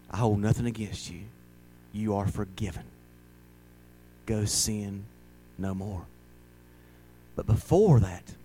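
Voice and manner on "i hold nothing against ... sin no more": soft, tender pardon; gentle forgiveness. Acted. Jesus forgiving the adulterous woman, softly and tenderly. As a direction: speak mercy to her quietly.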